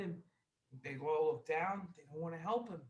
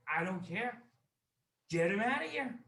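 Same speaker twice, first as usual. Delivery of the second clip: raised, higher-pitched angry command; acted-out irate dismissal, building louder; angry order; 5 semitones higher, 6 dB louder than usual. Wealthy man orders his servant to remove the guest, angry.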